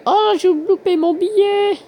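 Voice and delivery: high, loud, drawn-out lament; dismayed wail. Real, not acted. Player has missed their banknote, dismayed wail.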